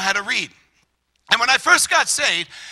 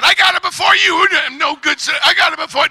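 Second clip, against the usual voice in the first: high, loud squabbling over who was first; mock quarrel; 7 semitones higher, 5 dB louder than usual. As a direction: argue loudly, as retold for laughs. Acts out the fight.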